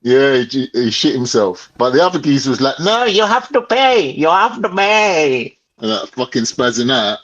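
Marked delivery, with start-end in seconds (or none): 2.8-5.6 put-on slow, higher Indian accent; slow imitated Indian accent, insisting. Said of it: "do, like, an Indian accent?".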